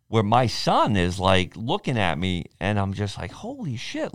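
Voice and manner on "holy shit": much higher and much softer, "shit" rising; hushed, amazed "Holy shit"; breathless wonder at his son; awed disbelief. Retold or imitated. Awed at his son's strength.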